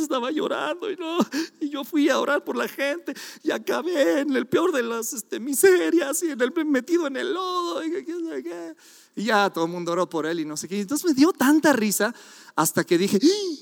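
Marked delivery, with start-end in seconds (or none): 0.0-8.7 llanto fingido, más agudo; imitando llanto. Retold or imitated. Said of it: "así en su co-, ríanse". 13.2-13.6 mock gasp. Exclamación de sorpresa citada.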